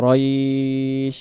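রইশ stretched very slowly; drawn-out রইশ. Shown as a demonstration. Teacher isolating রইশ in slow recitation. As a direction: hold রইশ long, ending on শ. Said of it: "রয় হবে শিনের সাথে".

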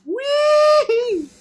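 high, gliding whee of delight; gleeful whee. Real, not acted. Player floating on balloons, high gleeful whee. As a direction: squeal like on a slide.

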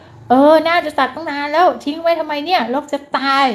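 annoyed wife griping at husband's work; nagging complaint. Pretend. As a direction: scold him for being late with it. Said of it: "น่าจะดีกว่าประโยค".

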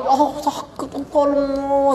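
high pained cry for help, hammed-up; mock scream of pain.

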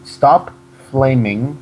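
slow, spaced-out re-reading; deliberate, separated words for the listener; slow repeat. Shown as a demonstration. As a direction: read it out word by word. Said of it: "say that again?".

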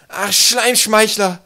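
high, drawn-out wail to the heavens; desperate cry. A narrator's reading. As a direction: cry out loudly in despair. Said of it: "rief er verzweifelt".

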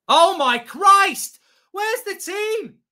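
high, slow horrified cry; panicked shout. Real, not acted. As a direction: shout in horror at the defence. Opponent attacks at the end.

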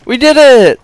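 high, loud victory cry; triumphant shout. Real, not acted. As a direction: cheer the win. Escaped with partner.

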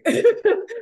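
loud high giggle; high laugh. Real, not acted. Laughing mid-sentence.

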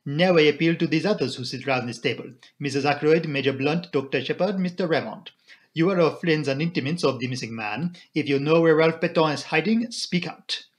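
put-on accented detective appealing to all; formal foreign sleuth, demanding an answer; Poirot. Poirot appeals to the table, firm and accented.

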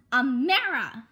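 loud, angry call of the name; sharp scold. Acted. Mother scolding her daughter in the car, loud. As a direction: snap her name out to stop her.

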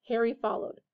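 ordinary delivery

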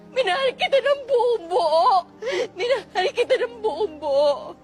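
crying, hurt lover's reproach; tearful heartbreak. Acted. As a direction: sob through the words, breaking down. Same breakdown, replayed. (umiiyak na panunumbat)

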